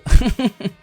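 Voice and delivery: short high laugh at his own joke; quick laugh in the middle of the story; amused laugh. Real, not acted. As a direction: laugh at yourself.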